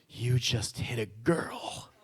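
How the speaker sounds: shocked whisper. Retold or imitated. Friends shocked at the punch.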